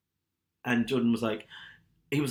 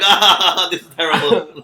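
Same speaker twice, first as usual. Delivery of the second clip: laughing mock despair; 5 semitones higher, 11 dB louder, slower than usual. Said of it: "we were all like".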